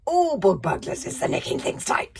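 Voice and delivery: loud, strange villain screech; screeching librarian. Acted. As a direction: screech it with spite. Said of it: "screeched the hairy, scary librarian".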